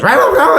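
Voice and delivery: loud, high-pitched roaring growl; monster growl. Acted. Creature trapped in a box, loud growly roaring. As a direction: roar wildly in bursts. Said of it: "growly sounds".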